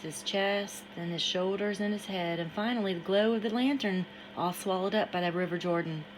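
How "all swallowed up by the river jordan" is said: ordinary delivery, nothing marked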